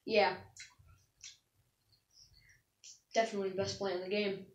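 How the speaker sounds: quiet, quick, smacking mouth noises. Shown as a demonstration. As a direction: talk while chewing noisily. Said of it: "Or just like this".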